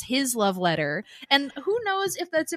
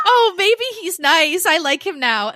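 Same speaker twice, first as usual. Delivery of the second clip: mock swooning; 6 semitones higher, 7 dB louder than usual. Kathy swooning, retold laughing.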